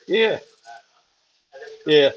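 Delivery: ordinary talk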